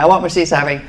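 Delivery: ordinary talk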